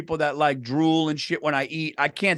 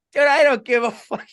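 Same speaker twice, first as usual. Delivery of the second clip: careless mock voice; 7 semitones higher, 4 dB louder than usual. Voicing Anthony's careless reply.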